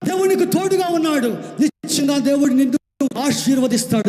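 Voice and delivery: high, loud, shouting proclamation; preaching at full force. Acted. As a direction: roar the blessing over the congregation. Climactic preaching over music.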